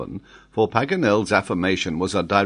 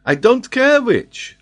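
put-on very high, loud French-accented dismissal; impatient French geographer; 9 dB louder, slower than usual. Geographer dismisses the Major's reading, impatient. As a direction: brush it off with impatience. Paganel brushes it aside.

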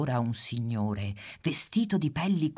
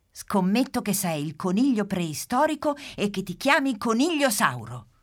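higher, louder teasing bet; playful guess; 7 semitones higher, 6 dB louder than usual. Acted. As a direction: sure of yourself, bet out loud. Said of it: "dice la pimpa".

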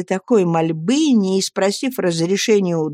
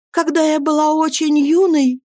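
put-on a higher, earnest young wife's voice; Annie pleading; 9 semitones higher than usual. Young wife begging her friends to speak, pleading.

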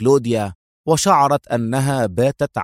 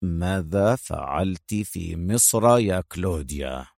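low, softer, slow stern repeat; slow low stern demand; sterner; 6 semitones lower, 5 dB quieter than usual. Acted. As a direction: press each word, low and slow. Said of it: "في مزيد من الصرامة".

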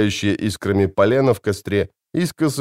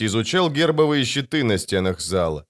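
usual speaking voice

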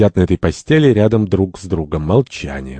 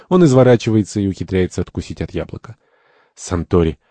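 ordinary delivery

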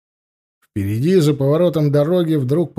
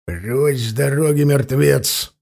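ordinary delivery

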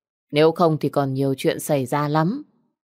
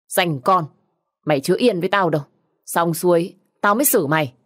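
furious scolding; 3 semitones higher, 4 dB louder than usual. Acted. Widow furiously curses the spiteful sister-in-law. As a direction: tear into her.

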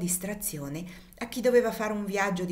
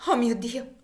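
higher, louder gasp of horror; shocked exclamation; 5 semitones higher, 4 dB louder than usual. Acted. Cassie realizing her lost daughter, a shocked cry. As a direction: cry out in sudden recognition. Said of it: "esclamò Cassie".

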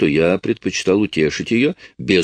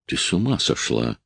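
shocked, forced out; 5 semitones lower, 6 dB quieter than usual. Acted. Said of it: "потрясённо выдавил я".

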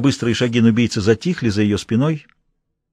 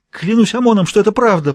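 choked desperate oath; 9 semitones higher, 4 dB louder than usual. A narrator's reading. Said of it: "прохрипела Амра из последних сил".

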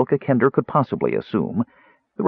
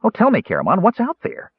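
high eager pleading; 12 semitones higher than usual. Acted. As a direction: coax the story out of him, high. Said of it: "Tass asked eagerly".

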